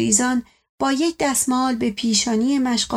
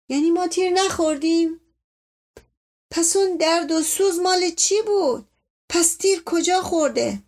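incredulous protest; 6 semitones higher than usual. Acted. Uncle and servant argue over wound.

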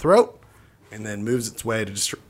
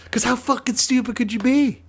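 mock outrage; 10 semitones higher than usual. Ranting about idiot.

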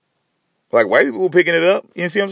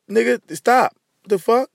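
usual speaking voice

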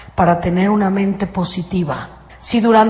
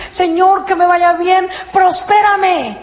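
a higher, pleading plea, ending in a shout; mock eager prayer; 7 semitones higher, slower than usual. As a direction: beg God for prosperity. Believer praying each morning.

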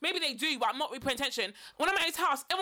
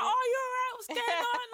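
mock bright greeting; 10 semitones higher than usual. Faking familiarity with stranger.

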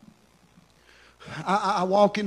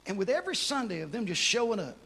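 much softer, low, tender appeal; soft altar-call preaching; 4 semitones lower, 8 dB quieter than usual. Acted. Pastor closing the sermon to the congregation, soft and tender. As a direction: draw them in gently, almost hushed.

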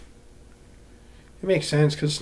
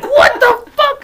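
very high, slow, squealing through laughter; cracking up at the guy moving back to Mexico; laughing hard; 9 dB louder, much slower than usual. Real, not acted. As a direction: barely get the words out for laughing. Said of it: "I was laughing so hard".

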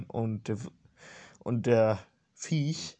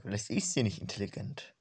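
usual speaking voice

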